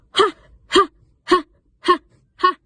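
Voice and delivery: sharp high ha breaths from the diaphragm; breathy ha exercise. Shown as a demonstration. Coach demonstrating the diaphragm warm-up, sharp high ha. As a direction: push each ha out from the belly. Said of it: "Do it five times".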